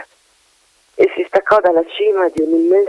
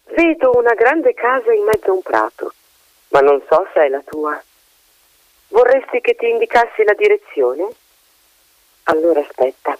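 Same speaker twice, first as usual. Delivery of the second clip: higher, lively bird voice for the magpie; chatty magpie; 5 semitones higher than usual. Acted. Magpie chatting down to the colonel, higher bird voice. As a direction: chatter like a gossipy magpie perched above.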